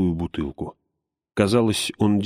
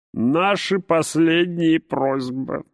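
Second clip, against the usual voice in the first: slow, high, forced-out words; strained; 10 semitones higher, much slower than usual. A narrator's reading. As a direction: struggle to get each word out. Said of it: "с трудом выговорил он".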